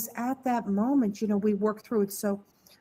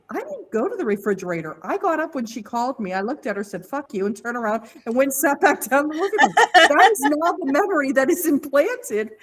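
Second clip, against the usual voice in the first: emphatic retelling, building much louder; retold defiance; 6 semitones higher, 8 dB louder than usual. Client proudly retells standing up to her mother, defiant and building. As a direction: build from firm denial to loud, punchy triumph.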